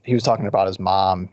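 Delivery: usual speaking voice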